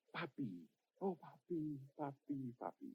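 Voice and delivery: very soft, intimate imitation of prayer; hushed retold plea; tender calling. Acts man tenderly calling God.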